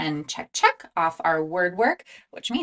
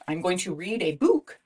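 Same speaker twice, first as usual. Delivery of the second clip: normal talking voice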